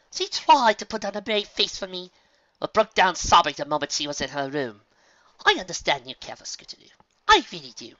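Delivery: high, loud insistent lady; firm demanding mare; Rarity pressing. Acted. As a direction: press him for an answer.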